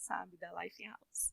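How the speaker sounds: slow, breathy whisper, barely voiced; slow whispered aside to the listener. Real, not acted. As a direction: drop to a secret whisper. Aside about her church cards.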